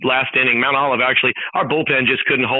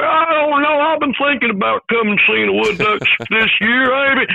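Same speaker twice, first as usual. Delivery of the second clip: high, drawled mock fan voice; hesitant fan; 9 semitones higher than usual. Guest mimicking a fan unsure about coming to games. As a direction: play a doubtful fan, pitched way up. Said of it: "everybody listening, come away".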